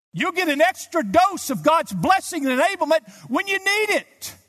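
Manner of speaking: preaching at full force. Real, not acted. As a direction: preach it out with rising fervour. Preaching climax on grace.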